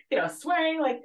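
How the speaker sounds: ordinary talk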